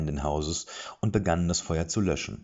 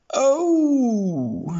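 very high, loud mock-wailed oh; overplayed shock; feigned dismay; 9 dB louder than usual. A narrator's reading. Dwarf pretends to be dismayed by the bar, hammed-up wail. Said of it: "sagte er gespielt bestürzt".

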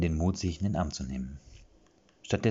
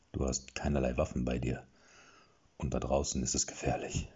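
low and somber warning; gloomy voice; 5 semitones lower, 3 dB quieter than usual. A narrator's reading. Warning the unarmed hero, somber low voice. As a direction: keep it dark and grave. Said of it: "sagte er mit seiner düsteren Stimme".

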